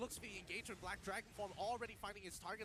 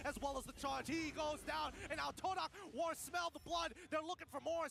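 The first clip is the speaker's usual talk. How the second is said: esports caster; 7 semitones higher, 5 dB louder than usual. Real, not acted. Hyped play-by-play of a fight.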